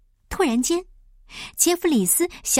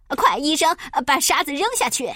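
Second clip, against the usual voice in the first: higher, pressing command; urgent order; 4 semitones higher than usual. Acted. Pilot to doctor as the balloon sinks, urgently ordering. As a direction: call it out in alarm.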